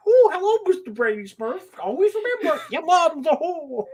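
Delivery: high, chirpy little Smurf; cheery Smurf greeting, friendly to the end. Pretend. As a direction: greet them sweetly like a tiny Smurf. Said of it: "sound like it's a Smurf".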